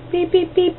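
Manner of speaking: high soft beep beep beep like a till; scanner beeps. Retold or imitated. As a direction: imitate a checkout scanner. Scanner noise imitated.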